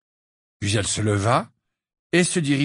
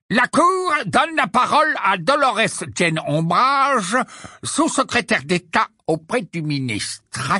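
formal announcement; 11 semitones higher, 4 dB louder than usual. A narrator's reading. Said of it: "annonça Fudge".

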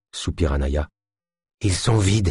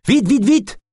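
very high, frantic urging; urgent yelping; 3 dB louder, slower than usual. A narrator's reading. De Lune hurries the others under gunfire, yelping. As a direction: yelp each word to rush them. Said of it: "glapit de Lune".

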